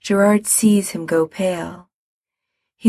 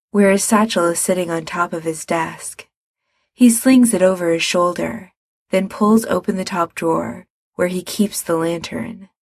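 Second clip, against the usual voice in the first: plain reading voice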